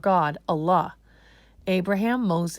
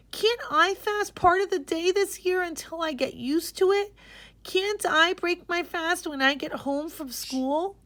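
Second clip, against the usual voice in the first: put-on pleading girl; 12 semitones higher than usual. Girl begs her father to fast only part of the day, high and pleading. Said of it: "Aisha cried out".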